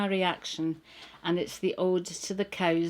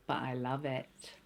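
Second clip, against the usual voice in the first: quiet; 7 semitones lower, 10 dB quieter than usual. Acted. Refrain, dropped low.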